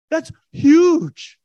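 high, loud burst of amazement; excited exclaim. Real, not acted. Preacher marvelling to congregation at the nephew's part, high and loud. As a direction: shout it out, amazed.

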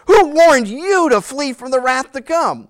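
acted prophet's disgusted rebuke. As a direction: demand it with open disgust. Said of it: "You can almost hear the disgust in his voice".

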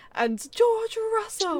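high, slow, drawn-out race call; imitating a race commentator announcing the win. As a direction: play the commentator announcing the winner.

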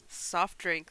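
ordinary talk

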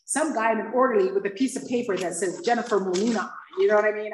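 normal talking voice

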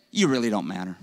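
lightly played blunt jab; mock put-down. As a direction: say it like a joke insult to your neighbor. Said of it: "Lean over your neighbor and say".

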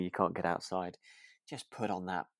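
normal talking voice

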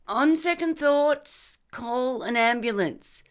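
high, drawn-out call. Acted. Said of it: "Lindy called out to us".

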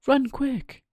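high, urgent call to run; panicked high "run quick". Acted. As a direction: hurry him away, high and tense. Cricket urges flight.

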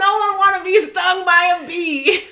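high, loud comic protest; loud outburst. Real, not acted. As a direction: cry it out in exaggerated outrage. Mock outrage about bee stings.

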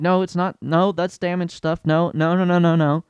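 patient but exasperated refusal; telling the mom no; insistent no. Retold or imitated. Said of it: "So I was like".

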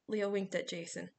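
ordinary delivery

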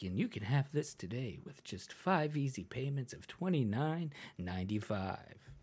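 soft-sell the payment plan; infomercial. Retold or imitated. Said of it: "a guy who would usually have that tone".

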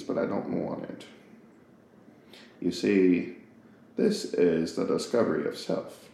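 a hint of a wise, measured narrator in a soft low register; Morgan Freeman. Baby thinking to itself, lightly done Morgan Freeman impression. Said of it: "that's my best Morgan Freeman".

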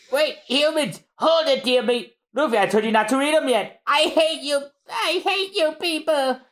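high, squealing outburst; squeaky angry Chopper. Acted. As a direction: squeal it out at them. Chopper yelling at the crew.

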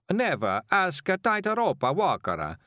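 high, slow mock-Italian; hammed-up Italian acrobat accent; fake Italian accent. A narrator's reading. Acrobat posing as a man from Brindisi, mock-Italian accent. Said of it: "pretended they were from Brindisi".

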